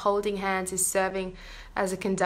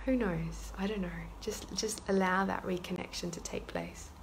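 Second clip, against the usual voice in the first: guided meditation. Acted. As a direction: ease them gently into the prayer.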